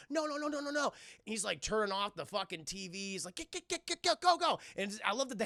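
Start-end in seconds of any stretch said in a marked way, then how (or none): 0.1-0.9 high, rapid-fire string of refusals; hammed-up frantic protest; mock panic. Boy frantic at hand watching TV. 3.4-4.6 high, staccato urging; mock-frantic hurry; frantic shooing. Boy shooing the hand away.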